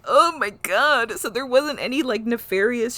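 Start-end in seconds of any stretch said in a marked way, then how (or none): 0.0-1.1 loud, high, drawn-out exclamation; retold shock, high and slow; stunned realization. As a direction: stretch it out in amazed disbelief. Retelling own stunned realization.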